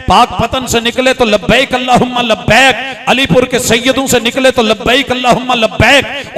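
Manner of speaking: loud, climbing, impassioned oratory; full-force preaching. Real, not acted. Preacher shows all pilgrims answer Allah alike, soaring forceful preaching. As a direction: build from town to town into a high cry of devotion.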